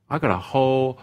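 ordinary delivery

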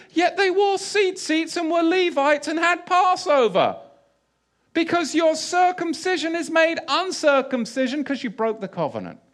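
high, ringing preacher's push; fervent preaching. Acted. Preacher rousing his listeners, fervent and high. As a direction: drive the point home to the congregation.

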